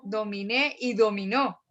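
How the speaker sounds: "dominé y dominó", stressed on NÉ and NÓ; stress on final syllables. Shown as a demonstration. Teacher contrasting verb forms, "dominé y dominó" stressed at the ends. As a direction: land on the last vowel of "dominé" and "dominó". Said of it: "el acento va al final".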